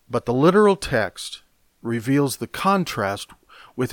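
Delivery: normal talking voice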